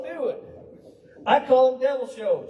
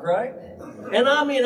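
usual speaking voice

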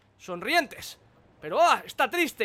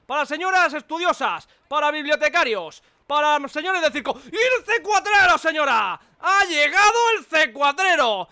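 loud, high market hawker; street vendor; 4 semitones higher, 6 dB louder than usual. Pretend. Salesman pitch hawking C4.

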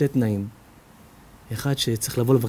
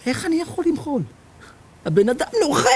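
indignant caller; 13 semitones higher than usual. Retold or imitated. Caller rages about in-law.

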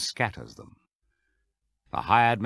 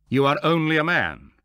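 higher, louder accusing cry; indignant crowd; 7 semitones higher, 6 dB louder than usual. Acted. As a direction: hurl the accusation at him. Crowd accusing Jesus of blasphemy.

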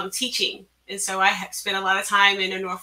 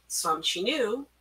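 plain reading voice

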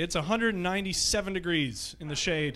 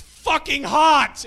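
much louder, higher and slow lion complaint; complaining lion; 9 semitones higher, 11 dB louder, much slower than usual. Pretend. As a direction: belt it out like a lion baking in the sun. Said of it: "Even the lions were like".

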